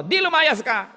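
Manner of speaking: high, loud mock shout; parent's angry retort. Parent yelling back at the runaway child, high loud shout. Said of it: "Yun naman ang sagot".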